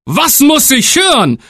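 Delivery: shocked father crying out; outraged exclamation. Acted. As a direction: tailor cries out in outrage. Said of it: "rief der Schneider".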